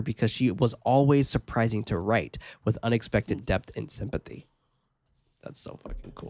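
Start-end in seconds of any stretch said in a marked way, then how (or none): 5.4-6.3 whispering. Real, not acted. Whispered aside.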